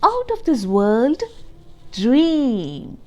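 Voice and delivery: put-on lively reading to young children; storybook read-aloud. A narrator's reading. Teacher reading Pete the Cat to the class, lively read-aloud. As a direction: read it like a picture book to kids.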